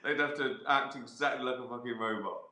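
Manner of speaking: words breaking into laughter; amused, laughing through it; laughing while talking. Real, not acted. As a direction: giggle through the line. Laughing at his own idea.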